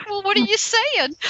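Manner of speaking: high-pitched, incredulous question; baffled retort. Retold or imitated. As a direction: squeak it out, puzzled by the shrink. Retelling a puzzled reply to shrink.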